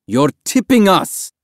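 high, loud, slow yell; alarmed shout. A narrator's reading. Man prying the boy's hands off the dinghy, alarmed shout. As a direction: shout a drawn-out warning. Man fights off clinging swimmer.

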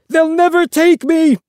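high, loud, wild defiance; crazed defiant yell. A narrator's reading. Father refusing rescue from the policemen, yelling. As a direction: scream it like a man who won't be caught. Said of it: "he cried".

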